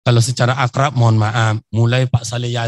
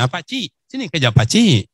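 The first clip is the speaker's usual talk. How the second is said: a Riau Malay uncle voice, higher, rising on betul; lightly done Malay accent, higher; 4 semitones higher than usual. Retold or imitated. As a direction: coax the old Malay pakcik, pitch climbing. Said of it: "Melayu ni".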